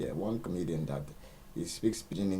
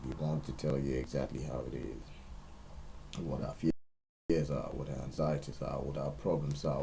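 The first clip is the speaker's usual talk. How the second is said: slow sincere mission statement; slow solemn statement; slower than usual. Real, not acted. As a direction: spell it out slowly and gravely. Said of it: "formal statement of aims".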